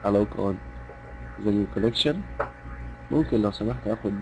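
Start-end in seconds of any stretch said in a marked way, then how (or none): 0.0-2.2 soft polite request. Shown as a demonstration. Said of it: "بهدوء، بيبقى صوت إيه؟".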